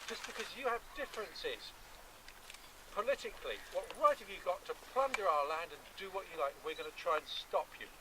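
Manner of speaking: normal talking voice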